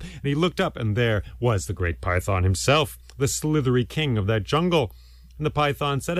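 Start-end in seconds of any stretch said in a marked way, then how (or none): none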